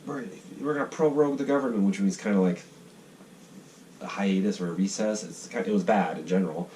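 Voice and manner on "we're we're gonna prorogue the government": high, put-on newsreader voice; a lightly hammed-up news anchor; mock news voice. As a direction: parody the TV pundits. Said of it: "talking about it nonstop on C B C".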